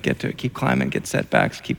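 whispered, weary refrain; tired whispered listing of the climb. Acted. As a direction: drop to a whisper. Preaching the endless climb.

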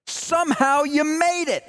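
high, ringing call across a crowd; a colleague shouting his welcome at the airport, high and elated; joyful shout. Retold or imitated. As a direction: shout it out, overjoyed to see him. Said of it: "he shouted out".

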